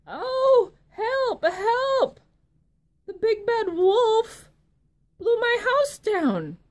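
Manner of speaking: high, loud panicked piglet squeal; frightened little pig. Acted. Little pig running to his brother, high panicked squeal. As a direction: squeal for help like a terrified pig. Fleeing pig cries for help.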